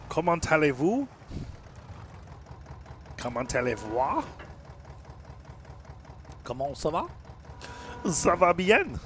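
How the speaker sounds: French class phrases in a hammed-up French accent; schoolroom French. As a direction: recite beginner French with playful rising questions. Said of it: "things I know from French class".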